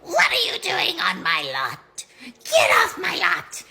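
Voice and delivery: put-on high, loud witch scolding kids off the lot. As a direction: shriek like a cranky witch at trick-or-treaters. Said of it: "and I'd go".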